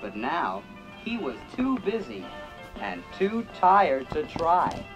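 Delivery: ordinary delivery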